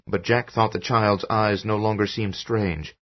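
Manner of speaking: usual speaking voice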